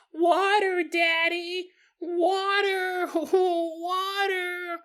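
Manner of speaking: put-on very high, whiny toddler cries; hammed-up high toddler wail; little kid voice. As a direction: wail it like a freaked-out small child. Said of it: "one of your little kid voices".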